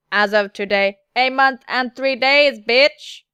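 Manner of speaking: loud, evenly spaced robotic words; steady android delivery with a punched last word. Acted. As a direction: announce it slowly like a machine. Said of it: "Something about the android voice".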